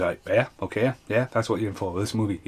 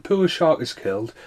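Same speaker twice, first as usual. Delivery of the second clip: ordinary talk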